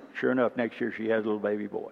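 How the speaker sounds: ordinary talk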